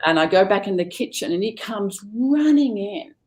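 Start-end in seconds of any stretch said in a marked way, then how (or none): none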